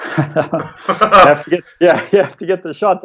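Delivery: laughing. Real, not acted. Laughing through answer.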